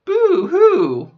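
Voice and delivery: high, loud fake wail; mock boo-hoo. Poking fun at her own voice with a mock wail. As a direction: play up a pretend cry. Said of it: "now I'm aware of it".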